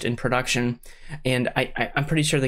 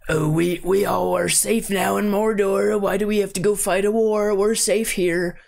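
imitated orc; 6 semitones higher than usual. Orc protesting to his leader in Mordor, high mocking voice. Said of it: "there's an orc guy that's talking to the leader".

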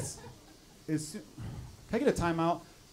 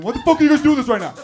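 loud, higher panicked mock yell; freaking out; 7 semitones higher, 10 dB louder, faster than usual. Comic voicing his absent sound guy, loud freaking-out yell. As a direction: play the sound guy losing it. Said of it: "He's probably freaking out going".

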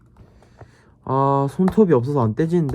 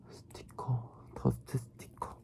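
whispered reading; 16 dB quieter, slower than usual. Real, not acted. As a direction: whisper the label to yourself. Reading instructions under breath. (속삭이며 읽기)

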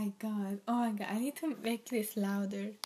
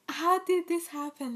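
higher and louder, genuinely thrown; baffled exclaim; 7 semitones higher, 5 dB louder than usual. Real, not acted. Fan reacting to a sudden music video, baffled exclaim. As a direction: demand it in real disbelief.

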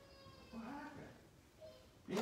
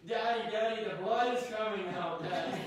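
put-on scared little boy; 7 semitones higher, 6 dB louder than usual. Retold son crying about bleeding.

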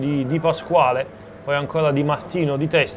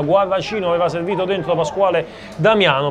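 ordinary talk

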